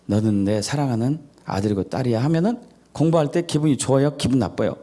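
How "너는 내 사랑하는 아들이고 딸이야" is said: soft, low, loving parent voice; tender parent. Retold or imitated. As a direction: reassure the child gently and lovingly. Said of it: "특히 알파파로".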